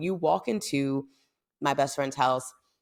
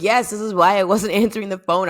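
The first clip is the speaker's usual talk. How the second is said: loud, higher retold reply; excited confirmation; 6 semitones higher, 9 dB louder than usual. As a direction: burst out with the confession.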